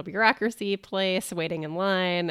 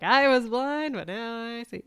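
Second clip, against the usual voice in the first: mock proclamation; 5 semitones higher than usual. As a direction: playfully quote a grand revelation, stretching the words. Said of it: "of just like".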